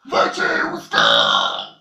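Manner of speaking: "Va chez Ousta" belched out slowly; slow burped phrase. Shown as a demonstration. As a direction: push "Va chez Ousta" out on one slow burp. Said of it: "en rotant".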